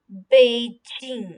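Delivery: बीजिंग slow and clear, falling at the end; Beijing blended. Shown as a demonstration. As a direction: blend बीजिंग into one smooth word. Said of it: "मिला के हम पढ़ते हैं". (बीजिंग धीरे, जोड़कर)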